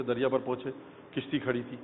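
ordinary talk

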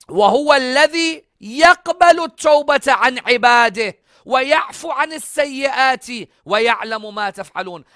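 high, loud, resonant Arabic recitation; Quran recitation. Acted.

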